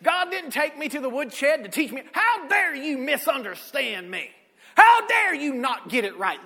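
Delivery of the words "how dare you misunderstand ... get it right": loud, pretend outraged scolding, peaking in a shout; mock angry rebuke. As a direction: play the angry father you say God is not. Voicing an angry God he rejects.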